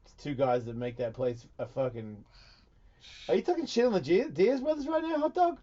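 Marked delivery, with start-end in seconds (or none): 3.3-5.6 high-pitched, needling challenge; mock confrontation. Host defends the Diaz brothers' town, mock confrontation. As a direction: play at picking a fight, pitched up.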